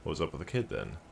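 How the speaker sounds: ordinary talk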